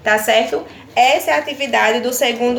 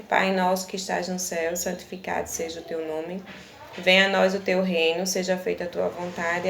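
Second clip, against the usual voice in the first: soft, even prayer recitation, quieter than her teaching voice; recited prayer; 4 semitones lower, 8 dB quieter than usual. Acted. Teacher praying the Our Father with the class, softly recited.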